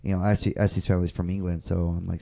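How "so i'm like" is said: ordinary talk, nothing marked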